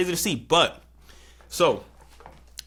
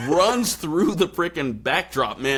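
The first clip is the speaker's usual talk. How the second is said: normal talking voice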